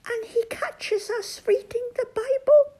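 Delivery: very high, tearful squeak; Toad, frightened. Acted. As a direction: fret in a tiny, panicky toad voice. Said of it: "whispered Toad".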